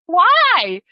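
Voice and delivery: high, loud, baffled why. Retold or imitated. Exchange student retelling shock at American pronunciation, baffled. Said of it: "I was like".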